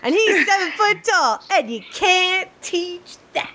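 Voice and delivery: high wrestler promo, slowing down; Enzo Amore impression. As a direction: sell it like a wrestler. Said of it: "one of my personal favorites is".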